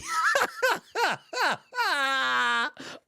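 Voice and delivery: long, high-pitched cackle; high helpless laughter. Real, not acted. Host laughing that the scammer never met him, high cackle. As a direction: crack up until it turns wheezy.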